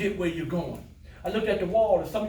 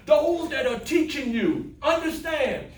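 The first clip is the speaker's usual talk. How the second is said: impassioned praise; 6 semitones higher than usual. Real, not acted.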